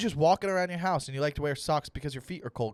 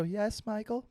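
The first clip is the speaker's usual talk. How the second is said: higher-pitched, mock British mother answering; British mom; 6 semitones higher, 3 dB quieter, slower than usual. Mother answering her son, British accent. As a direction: imitate a British mum's patient reply. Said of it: "My mom's British".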